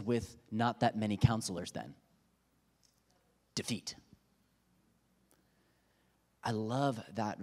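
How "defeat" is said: whispered answer. Real, not acted. Dramatic one-word answer.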